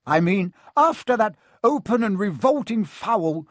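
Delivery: high, indignant commentary; biased commentator. A narrator's reading. Commentator correcting himself but still taking sides, indignant. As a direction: press on with the outraged announcement.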